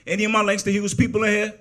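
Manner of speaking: high, loud shout-out to the audience; calling out to crowd. Real, not acted. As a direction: project it up and out to find your people.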